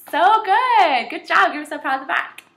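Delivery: high, loud praise; excited praise. Real, not acted. Teacher praising scholars after they repeat, excited and bright.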